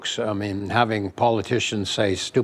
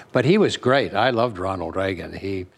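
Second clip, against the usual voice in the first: ordinary delivery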